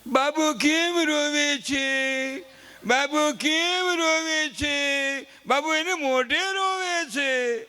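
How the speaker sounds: slow, high cooing mother's voice, drawn out; fussy doting mother. Retold or imitated. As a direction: coo over the baby like an anxious mom. Mother fussing over crying child. (લાડ કરતી મમ્મીનો ઊંચો, ધીમો અવાજ)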